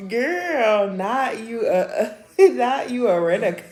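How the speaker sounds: loud teasing broken up by real laughter; laughing mockery. Real, not acted. Mocking the security guard to the chat, laughing loudly. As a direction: roast her while cracking up.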